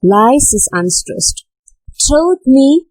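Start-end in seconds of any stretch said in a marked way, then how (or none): 2.0-2.9 "Trod me" pitched high, "Trod" as the strong beat; raised, emphatic "Trod me"; stress on TROD. Shown as a demonstration. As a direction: lean on "Trod" in "Trod me". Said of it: "trod is stressed".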